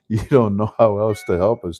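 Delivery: ordinary delivery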